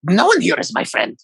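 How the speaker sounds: high, wondering prisoner voice; bewildered creature voice. Acted. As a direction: ask it in disbelief.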